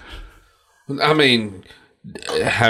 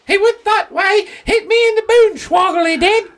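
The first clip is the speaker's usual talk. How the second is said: very high shrieking granny with a put-on British voice; old British lady shouting. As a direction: yell it like a battered little old lady. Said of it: "And she's like".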